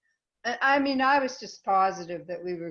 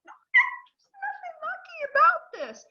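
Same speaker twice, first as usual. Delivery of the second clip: upset outburst; 7 dB louder than usual. Retold or imitated. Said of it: "I was like".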